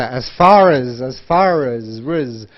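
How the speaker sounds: "As far as" joined up, ending as "riz"; linked words. Shown as a demonstration. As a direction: drill the link from "far" into "as". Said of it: "link it together".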